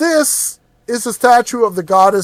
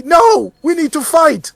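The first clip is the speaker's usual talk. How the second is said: high, firm insistence; 10 semitones higher than usual. Acted. Adventurer insists on fighting.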